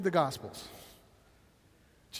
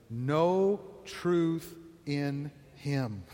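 slow recitation; 5 semitones lower, 4 dB quieter, much slower than usual. Shown as a demonstration. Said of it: "can you say it with me?".

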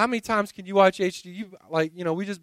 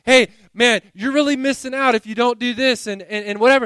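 louder pushy tempter; 5 semitones higher, 7 dB louder than usual. Pretend. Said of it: "the world comes and says".

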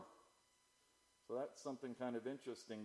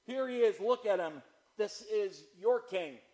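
loud, raised taunt, slowing at the end; 7 semitones higher, 9 dB louder than usual. Acted. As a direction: goad the crowd with it.

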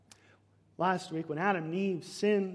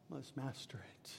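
whisper; 6 semitones lower, 18 dB quieter than usual. Acted. God warning Cain, whispered end.

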